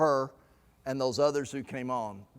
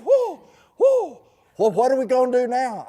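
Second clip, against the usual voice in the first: high, loud stunned whoa, then a fast question; imitating a man reeling after his big commitment; 7 semitones higher, 6 dB louder than usual. Doctor recovering downstairs, asking in high loud surprise. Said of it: "He says".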